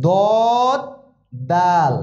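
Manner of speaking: দদ said heavy and full in the throat, দাল said light and clear; slow drill of single Arabic letter names, each held apart. Shown as a demonstration. Teacher drills the letter sounds for students, slow and careful. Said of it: "দদের উচ্চারণ একরকম".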